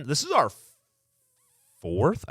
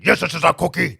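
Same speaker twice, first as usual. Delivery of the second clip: Cookie Monster voice; 4 semitones higher, 4 dB louder than usual. Acted. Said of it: "they said to me".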